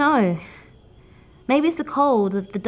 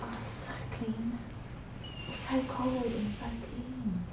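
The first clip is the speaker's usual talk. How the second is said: awed; 15 dB quieter, slower than usual. Acted. Awed by the sea air.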